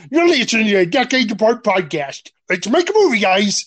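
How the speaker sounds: high-pitched hammed-up show opener; exaggerated, peppy podcast intro; mock podcast host. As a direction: put on a cheesy intro voice. Acts out the rejected podcast intro.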